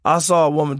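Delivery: ordinary delivery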